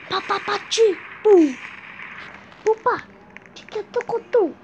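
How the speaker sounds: beatbox the fight with your mouth; mouth sound effects. Pretend. Mouth noises during the fight. (efeitos sonoros com a boca, mais agudos)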